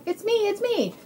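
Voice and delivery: very high, loud happy reveal; excited duck. Acted. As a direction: squeal it as the unmasked duck.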